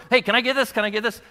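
put-on high, eager child's plea; begging kid. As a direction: ask like a kid tugging a sleeve. Kids begging parent in store.

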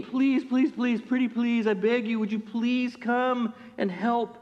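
high, whiny begging with slow drawn-out please; mock pleading. Elders begging Jesus for the centurion, mock pleading. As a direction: beg like a child asking for a treat. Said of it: "But they're pleading".

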